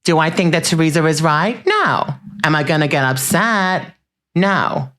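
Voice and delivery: questions answered by high, falling one-word refusals; Dolores. Acted. Podcast host imitates a reality star answering her own questions, swooping impression. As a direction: ask yourself in a housewife's voice, then swoop the answer. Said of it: "That's Dolores".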